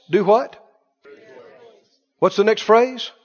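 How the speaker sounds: ordinary talk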